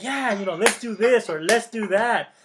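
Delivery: cheerful coworker rallying people; upbeat at work. Retold or imitated. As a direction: pump up the team. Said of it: "and I'm just like".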